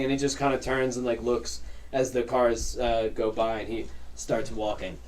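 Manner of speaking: ordinary talk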